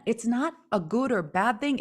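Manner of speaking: ordinary talk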